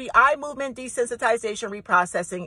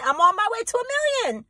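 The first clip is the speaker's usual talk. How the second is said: same words again, jumping much higher; pitched way up; 10 semitones higher than usual. Real, not acted. Vlogger hyping her million-follower goal, pitched up high. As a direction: lift it up as the excitement builds. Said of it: "I'm at half a million".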